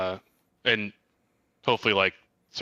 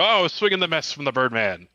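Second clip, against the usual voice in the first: hammed-up sportscaster, a touch higher; frantic mock sports commentary; 9 semitones higher, 6 dB louder than usual. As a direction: call it like a ringside commentator. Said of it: "try to commentate as we go through".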